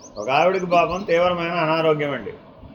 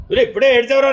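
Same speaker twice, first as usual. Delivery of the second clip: higher, louder teasing scold; scolding mother; 7 semitones higher, 5 dB louder, much faster than usual. Retold or imitated. Mother calling out her son's denial of crying, loud and high. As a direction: catch him out loudly.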